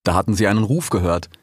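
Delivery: ordinary delivery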